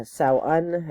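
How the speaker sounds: Samhain said slowly as "SOW-un"; Samhain pronounced SOW-un, slow; alternative pronunciation. Shown as a demonstration. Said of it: "which can also be pronounced".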